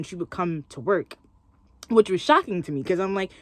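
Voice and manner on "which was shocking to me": normal talking voice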